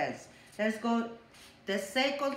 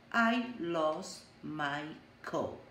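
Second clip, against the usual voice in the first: calm statement; 5 semitones lower, slower than usual. Shown as a demonstration. Teacher showing class a calm problem statement. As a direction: say it slowly once you've calmed down. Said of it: "and then say the problem".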